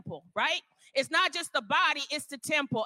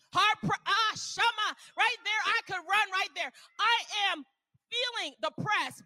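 much higher, fervent cry; preacher ecstasy; 7 semitones higher than usual. Real, not acted. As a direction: caught up in the spirit. Preacher gets happy, cries out.

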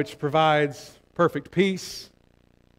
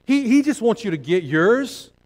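ordinary delivery

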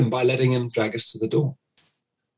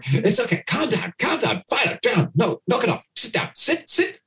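fussing owner; 8 semitones higher than usual. Retold or imitated. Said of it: "coming in going".

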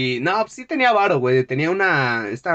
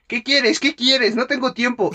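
aggressive professor; 7 semitones higher than usual. Retold or imitated. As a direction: snap it out like a busy, annoyed teacher. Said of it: "sale bien agresivo".

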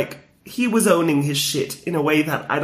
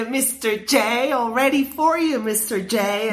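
slow, sing-song, lightly higher Harley; Harley Quinn voice; 5 semitones higher, slower than usual. Retold or imitated. Fan imitating Harley calling the Joker, lightly voiced. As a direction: lightly play Harley's flirty call. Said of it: "And she".

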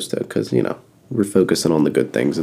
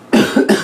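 loud hacking cough; harsh cough; 4 dB louder than usual. Real, not acted. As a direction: cough hard into the mic. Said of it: "I'm dying".

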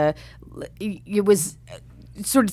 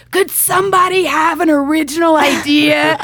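exasperated; 9 semitones higher, 6 dB louder than usual. Retold or imitated. Exasperated executive reacts.

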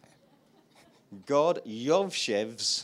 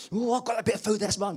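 higher, louder squawky chicken voice; greedy chicken; 8 semitones higher, 7 dB louder, faster than usual. Pretend. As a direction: play a grabby chicken guarding its food.

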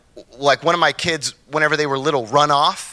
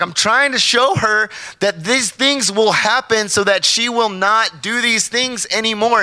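high, slow, insistent explaining; defensive father; 9 semitones higher, slower than usual. Retold or imitated. Father justifying himself to his wife, high and slow. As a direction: justify yourself, drawn out.

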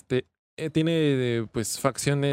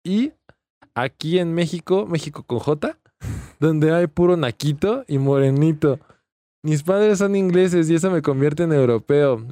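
loud hammed-up reading of a post; loud mock reading; 5 dB louder than usual. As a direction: read the post out loud, mocking. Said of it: "Y dice".

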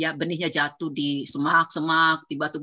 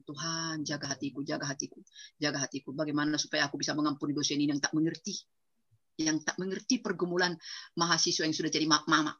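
soft, lower, murmured plea; quiet prayer; 5 semitones lower, 6 dB quieter than usual. Retold or imitated. Mother praying to God about her lecturer, soft and low. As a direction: breathe it as a private plea. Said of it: "Bunda bilang".

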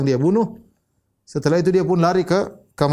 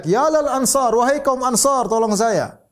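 calling for help; 9 semitones higher, slower than usual. Retold or imitated. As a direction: cry out to the Ansar for rescue. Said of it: "Maka dia teriak".

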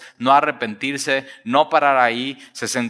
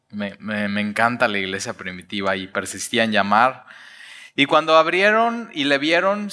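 ordinary delivery

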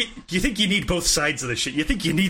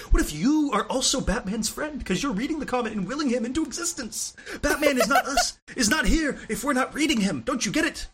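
put-on failed Glasgow accent, pitched high; Grant Morrison parody; 7 semitones higher than usual. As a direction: plead it in a bad attempt at a Glasgow accent. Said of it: "I can't do a Grant Morrison accent".

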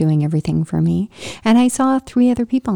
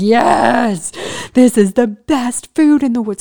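louder, excited cry of joy; delighted exclamation; 3 semitones higher, 5 dB louder than usual. Retold or imitated. To herself over hospital gravy, a louder delighted cry. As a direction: gush about the food like it's heaven. Quoting her own delight at food.

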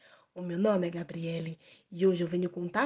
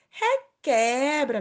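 louder, slow, higher requebra; loud drawn-out call; 5 semitones higher, 7 dB louder, much slower than usual. A narrator's reading. Sung-out call in story.

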